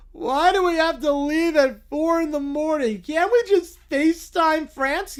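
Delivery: high, slow, whining cartoon bear; whiny cartoon bear. Little Brown Bear complains about the flight.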